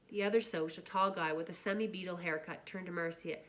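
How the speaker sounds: usual speaking voice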